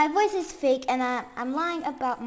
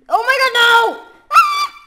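higher, louder panicked cry; shocked scream; 11 semitones higher, 17 dB louder than usual. Real, not acted. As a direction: yell as it all breaks. Build goes wrong.

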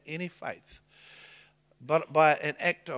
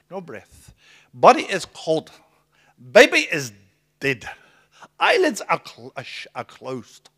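retelling interpreter; 11 dB louder than usual. Retold or imitated. Interpreter reporting dead baby.

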